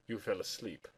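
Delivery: soft, low murmur. Acted. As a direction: say it quietly from across the room. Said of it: "Sherlock murmured".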